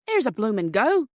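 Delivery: loud, startled workman's exclamation; a rough railwayman caught off guard; startled engine man. A narrator's reading. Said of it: "The man said".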